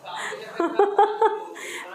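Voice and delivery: breathy burst of laughter; high breathy laugh. Real, not acted. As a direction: laugh out at the son's excuse. Laughs at son's reply.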